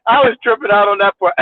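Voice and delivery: normal talking voice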